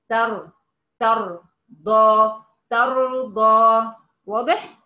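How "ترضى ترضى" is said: ترضى slowly and louder, light taa and heavy raa; slow correct recitation of ترضى; correct demo. Shown as a demonstration. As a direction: model ترضى right, taa thin, raa full. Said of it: "تاء، تاء،".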